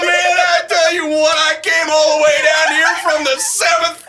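loud high manic shouting; Chris Farley impression. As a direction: yell it like a wild comic. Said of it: "Chris Farley".

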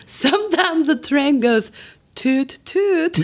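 a playful train whistle imitation; silly toot sound. Shown as a demonstration. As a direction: make the little toot sound for the kids.

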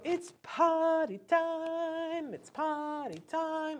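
high, slow sing-song call; bright drawn-out call to the toddler; cheerful announcement. Shown as a demonstration. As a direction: make potty time sound like a treat. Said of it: "we're gonna go".

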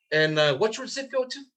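high, lilting add-on question; breezy offhand ask; no big deal. Shown as a demonstration. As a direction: slip it in casually. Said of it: "You see, not a big deal".

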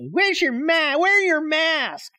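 high, put-on voice of a scolding driver; mock yelling stranger. Imitating driver who yelled at him.

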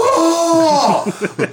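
a loud, very high collective "Oh!" with a laugh; the whole crowd recoiling at once; mock shocked gasp. Whole party reacting in shock.

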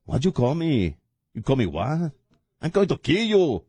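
hammed-up menacing comeback; mock tough guy. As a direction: play an offended street tough. Acted west-side tough reacting.

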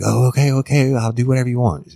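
high-pitched frantic compliance, hurried at the end; freaked out. Retold or imitated. Woman giving in to her attacker, freaked out. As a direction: imitate a frightened woman giving in fast. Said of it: "she was freaked out".

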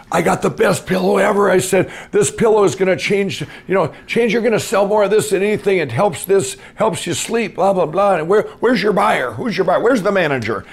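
high, loud, fast-building sales pitch; passionate pitch. Retold or imitated. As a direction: gush like an overeager salesman demanding the buyer. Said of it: "I just had all this passion".

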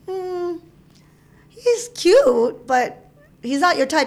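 high-pitched imitated cousin, doubtful; retold reluctant approval; hedging verdict. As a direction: sing out the praise high, then ease down into the catch.

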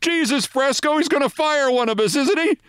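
very high, frightened realization; dawning dread. A narrator's reading. Manager realizing someone gets fired, high-pitched dread. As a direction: squeak out the fear, pitched far up. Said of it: "now the worst suddenly occurs to Buzzy Bavasi".

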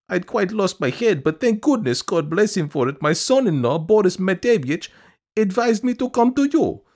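high-pitched, gushing supplicant; high, pleading woman's voice; insistent petitioner. A narrator's reading. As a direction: beg a favour in a piping high voice.

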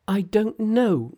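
put-on high, slow, timid girl's voice; frightened daughter. Daughter's voice in read story.

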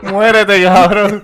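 high, loud, through laughter; laughing mock curse. Friend repeats the insult from the message, laughing loudly. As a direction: crack up while cursing.

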